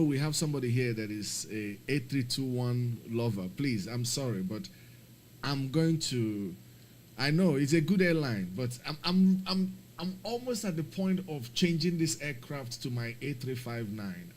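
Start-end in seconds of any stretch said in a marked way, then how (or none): 5.4-14.3 frustrated. Real, not acted. Frustrated rant about the aircraft.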